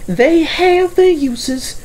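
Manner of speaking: high, slow, put-on car voice; a prim old car, pitched high and drawn out; Caroline's voice. A narrator's reading. Caroline admitting trains are useful, high and slow. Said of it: "she says".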